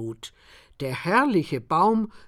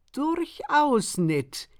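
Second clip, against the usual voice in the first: slow, higher and knowing; sly; 8 semitones higher, much slower than usual. A narrator's reading. Farmer answers the exhausted narrator slyly and slowly. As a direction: draw it out slyly. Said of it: "erwiderte er listig".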